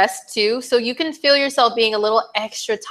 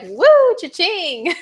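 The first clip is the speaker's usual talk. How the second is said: high squealing woo, then a cha-ching; playful mock spending cheer; excited cash whoop; 9 semitones higher, much slower than usual. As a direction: whoop like money just flew out. Imagining big spenders' excitement.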